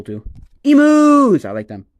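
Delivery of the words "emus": high, loud, drawn-out cheer; excited exclamation. Real, not acted. Streamer spots emus on a card, excited shout. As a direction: call it out with glee.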